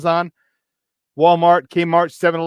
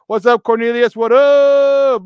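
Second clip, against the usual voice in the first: loud hype greeting; 5 semitones higher, 7 dB louder, slower than usual. Acted.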